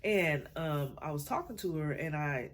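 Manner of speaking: ordinary talk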